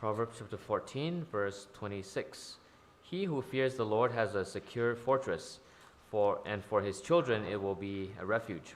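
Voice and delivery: usual speaking voice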